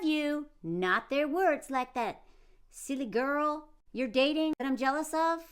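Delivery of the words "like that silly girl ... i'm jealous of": ordinary delivery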